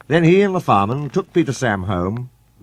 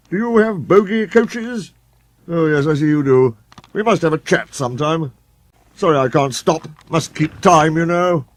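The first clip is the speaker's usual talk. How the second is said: pompous engine; 6 semitones higher, 4 dB louder than usual. A narrator's reading. Sir Handel boasting to Gordon.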